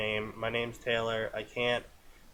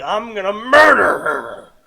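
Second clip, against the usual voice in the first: loud, slow mock yell of rage; hammed-up furious shout, much louder; mock murderous yell; 7 semitones higher, 12 dB louder than usual. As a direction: bellow the threat in hammed-up fury. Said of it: "she's like".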